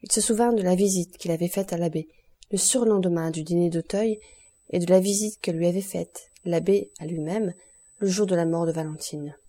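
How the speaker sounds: usual speaking voice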